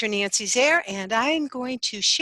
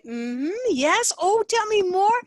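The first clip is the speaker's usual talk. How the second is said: high, bright "tell me more" swooping up loudly; eager chatty buyer; 9 semitones higher, 5 dB louder than usual. Retold or imitated. Imitating the friendly buyer who interrupts to bond, eager and singsong. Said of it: "They say".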